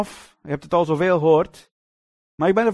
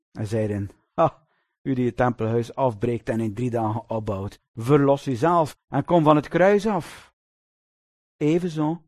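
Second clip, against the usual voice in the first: measured, lower Bible reading voice; scripture reading; 4 semitones lower than usual. Acted. Preacher reading the crucifixion passage to the congregation, solemn.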